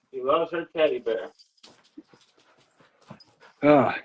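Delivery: ordinary talk, nothing marked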